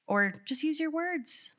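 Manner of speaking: high, slow, patronizing "use your words"; scolding parent. Retold or imitated. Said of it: "old phrases".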